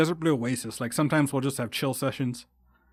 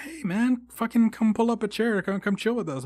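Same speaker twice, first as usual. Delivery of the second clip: much higher, welcoming call; friendly invitation; 8 semitones higher than usual. Retold or imitated. Group inviting lone stranger over, high and friendly. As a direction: invite a loner to join the table. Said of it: "They're like".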